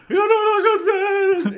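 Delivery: long, slow high shriek; mock screaming and yelling. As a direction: imitate a man wailing loudly at the top of his pitch. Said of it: "he started yelling and screaming and yelling".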